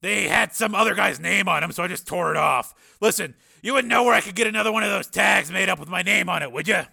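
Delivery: brash reporter's cover story; Steele bluffing breezily. Acted. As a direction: explain it away loudly and casually. Narrator voices Steele bluffing on.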